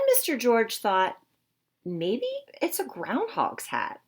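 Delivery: lively, expressive storybook voice; reading to children. Acted. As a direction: read it to a class of young kids. Reads picture book to children.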